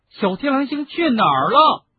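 high, loud shout after him. A narrator's reading. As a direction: yell after the leaving elf. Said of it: "大声喊道".

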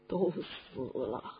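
dropping low and soft in grief; sorrowful hush. Acted. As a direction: let the words sink into grief. Recounting the deaths of a child's parents. (悲伤低沉)

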